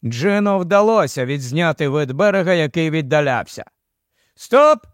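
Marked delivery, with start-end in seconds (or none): 4.3-4.8 loud, high-pitched director's call; sharp raised command. A narrator's reading. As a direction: call a halt to the shoot. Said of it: "скомандував нарешті Барні".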